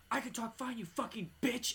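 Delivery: high-pitched retold snap; mock angry outburst. Woman turns on the female cop, mock angry outburst. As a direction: imitate her sudden high-pitched fury. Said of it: "she would go from screaming to whispering".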